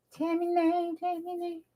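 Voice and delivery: put-on high, broken-English baby voice begging; mock childish pleading. Crafter begging viewers for the name, high babyish whine. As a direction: whine it like a little kid.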